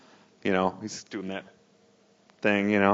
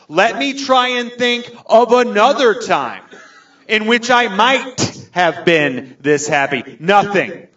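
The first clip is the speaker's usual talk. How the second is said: high, slow, overblown gushing; acted ecstatic sobbing declaration; hammed-up drunken bawling; 10 semitones higher, 5 dB louder, slower than usual. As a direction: proclaim it word by word in a mock-drunk wail. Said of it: "I am drunk. I am saying outlandish things".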